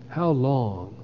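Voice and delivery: ordinary talk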